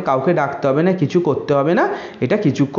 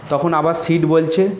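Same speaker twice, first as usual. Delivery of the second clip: normal talking voice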